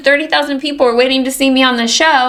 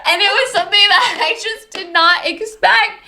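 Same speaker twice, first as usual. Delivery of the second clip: high-pitched, giggly amazement; amazed, laughing; 9 semitones higher than usual. Real, not acted. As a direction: talk through the tail of a laugh. Laughing at own viral story.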